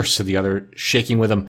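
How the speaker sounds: ordinary delivery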